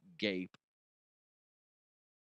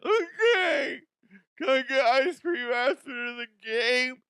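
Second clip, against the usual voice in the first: much higher, louder crybaby whine; whiny child LeBron; 12 semitones higher, 7 dB louder than usual. Retold or imitated. Mocking LeBron tattling to the ref, high crybaby whine. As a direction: wail it like a tattling kid.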